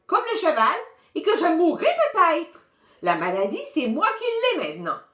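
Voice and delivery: put-on high child's voice, worried and urgent; lightly voiced scared little boy; a scared kid talking himself into illness; frightened child. A narrator's reading. Guigui's frightened rant continues.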